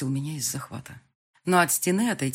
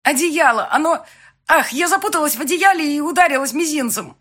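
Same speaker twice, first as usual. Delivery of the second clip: much higher and louder; raised voice; 11 semitones higher, 11 dB louder than usual. Acted. Bride loudly sending fiancé off through the door. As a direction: call him away, strained.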